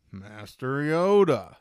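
slow, drawn-out call to a master; mock pleading Luke. Luke calls out to Yoda, slow and drawn out. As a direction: stretch it out, calling to the old master.

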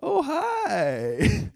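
very high, cutesy greeting; little simpering phone voice. Shown as a demonstration. Showing how they answer the phone, high simpering voice. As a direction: answer the phone in a squeaky simp voice. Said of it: "you've heard me answer the phone like".

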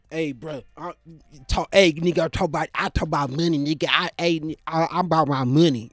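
mock money talker. Mocking money-talking caller.

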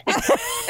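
very high squealing laugh; delighted shriek of laughter; burst of laughter. Real, not acted. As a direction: crack up. After Jen's reaction.

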